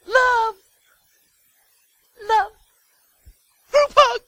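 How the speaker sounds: tiny, very high falsetto; mock love-and-hugs angel. Preacher mocking angels flying around, silly falsetto. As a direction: coo it in a silly high squeak. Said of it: "They weren't flying around going".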